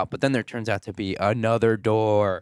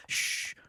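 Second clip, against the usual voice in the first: soft hushing sound; low-level warning "shh"; urgent shush; 11 dB quieter than usual. Retold or imitated. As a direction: hush her quietly. Keith hushing her in retelling.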